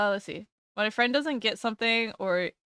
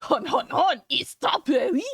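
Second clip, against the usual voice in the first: hammed-up cartoon French; mock French accent; 4 semitones higher than usual. Streamer quoting a French strawberry meme, mock French accent. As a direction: quote it in a silly French voice. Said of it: "I got another French meme".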